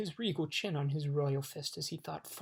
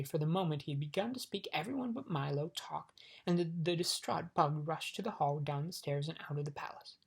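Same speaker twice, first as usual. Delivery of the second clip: ordinary delivery, nothing marked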